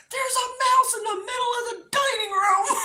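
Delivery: very high, hysterical wail; shrieking in mock terror, half laughing; screamed. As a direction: reenact a panicked scream. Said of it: "I screamed and I said".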